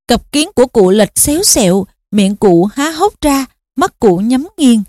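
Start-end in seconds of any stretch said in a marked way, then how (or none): none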